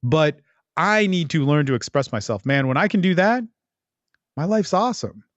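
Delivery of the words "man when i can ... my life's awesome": ordinary talk, nothing marked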